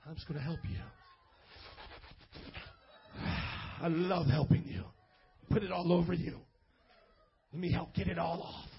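low, mock seductive come-on; sleazy flirt. Pastor mocking a guy flirting in a dim corner, low and sleazy. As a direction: lay it on thick like a creep.

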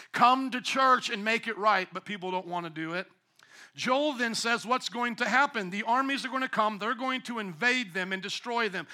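usual speaking voice

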